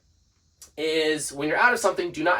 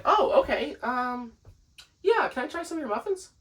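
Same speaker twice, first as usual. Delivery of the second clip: pleased customer; 10 semitones higher than usual. Retold or imitated. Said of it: "They'll be like".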